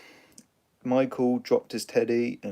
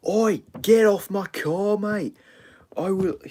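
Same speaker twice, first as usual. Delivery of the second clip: put-on angry old bloke; 11 semitones higher, 5 dB louder than usual. Old driver yelling at Peter on his car, high angry shout. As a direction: shout him off the car like a cross old geezer. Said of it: "this old bloke was like".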